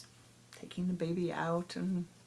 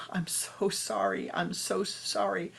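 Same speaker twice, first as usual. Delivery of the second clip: louder, slow, tearful confession; breaking down; 4 semitones higher, 6 dB louder, slower than usual. Acted. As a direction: pour out the apology as she falls apart. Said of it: "broke down and said".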